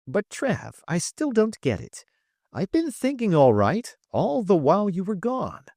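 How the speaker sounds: put-on young Trip. Trip's voice higher.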